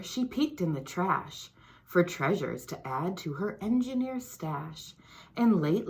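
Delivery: put-on lively storybook read-aloud for kids; reading to children. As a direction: read it like story time for little ones. Reads picture book to children.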